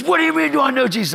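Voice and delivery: high, incredulous mock yell; indignant high-pitched outburst; retold screaming. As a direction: imitate a hurt man screaming back. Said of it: "he's screaming at me".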